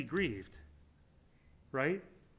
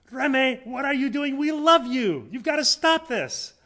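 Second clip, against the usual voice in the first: much higher and louder, an urgent loving shout; yelling at her friend; 9 semitones higher, 9 dB louder than usual. Retold or imitated. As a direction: call her name out hard, pleading her to stop. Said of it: "she just yelled at her".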